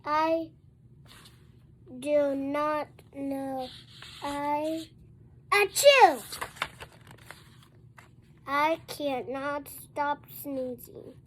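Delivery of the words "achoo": sneeze. Acted. Character sneezes in the story.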